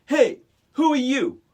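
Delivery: loud, high tough-guy challenge; tough gang member. Retold or imitated. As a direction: threaten like a gang thug. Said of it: "he's like".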